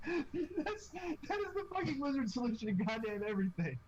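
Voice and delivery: real laughter, pitched high at first; laughing. Real, not acted. Player cracks up at the wizard's Fireball answer, laughing.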